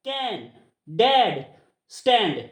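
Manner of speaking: "कैन, डैड, स्टैंड" slowly, with open ae; open ae sound. Shown as a demonstration. Teacher showing which sound comes, open ae in "कैन, डैड, स्टैंड". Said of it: "ये कैसे sound आ रही है".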